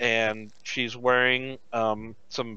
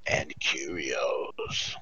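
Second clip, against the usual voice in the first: low, slow, husky whisper; a sultry chain smoker beckoning; heavy smoker voice; 7 semitones lower, slower than usual. Acted. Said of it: "She sounds like she's a smoker, like a heavy smoker".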